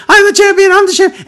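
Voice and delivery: very high, loud victory squeal; imitated jubilation. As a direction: act out a new champion celebrating wildly. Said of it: "all of a sudden lights up".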